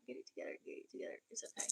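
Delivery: whispered to herself, voiceless. Real, not acted. Fixing her hair on stream, whispering a pep talk to herself. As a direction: hush it under the breath as a private pep talk. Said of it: "come on, girl".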